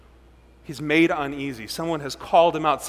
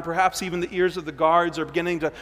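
ordinary talk

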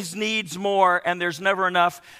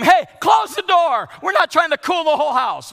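loud, high, slowed scolding shout; mother's yell; 8 semitones higher, 5 dB louder than usual. Retold or imitated. Mother yelling at the teenager at the open fridge, loud scolding. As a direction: bark it like a fed-up mom at the fridge. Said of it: "the mothers yell and scream".

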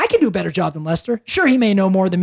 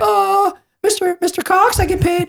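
high, meek stammer to the veteran; timid newbie voice; 11 semitones higher than usual. Retold or imitated. Young agent timidly answering the veteran, high and meek. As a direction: squeak it out like a nervous rookie.

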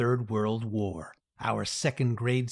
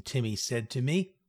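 plain reading voice

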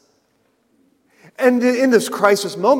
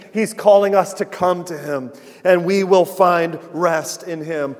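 ordinary talk, nothing marked

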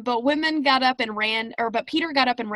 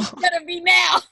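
playful shout; 7 semitones higher, 7 dB louder than usual. Pretend. Echoing the joke with a shout.

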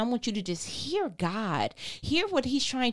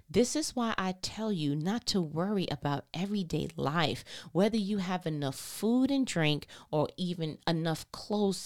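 calm, lower, measured Bible reading; scripture reading; 4 semitones lower, 5 dB quieter than usual. Acted. Reads Matthew aloud again.

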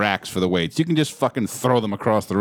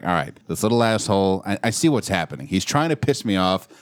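usual speaking voice

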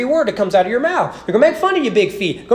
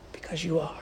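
unvoiced, much softer whisper; 5 semitones lower, 15 dB quieter than usual. Real, not acted. Pastor whispering to the congregation that they are broken. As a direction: breathe the answer like a confession. Sermon aside after a rhetorical question.

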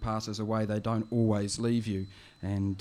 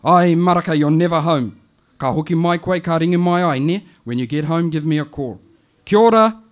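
a touch louder, chatty auntie on the phone; an auntie leaving a friendly message; a hint of the auntie voice; lightly voiced auntie; 7 semitones higher, 14 dB louder than usual. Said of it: "it goes like this".

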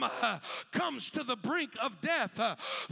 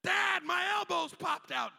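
put-on much higher, louder kid's complaint; kid's whine; 6 semitones higher, 4 dB louder than usual. Kid shouting to his father about his elbow, mock whiny. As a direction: whine it up to Dad.

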